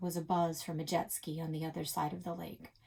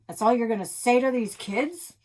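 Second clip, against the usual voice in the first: put-on loud grumpy old man; grumpy outburst; 5 semitones higher, 9 dB louder than usual. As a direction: challenge him, gruff and loud. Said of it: "Grandpa grumped".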